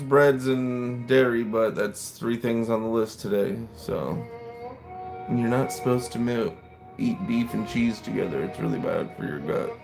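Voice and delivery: normal talking voice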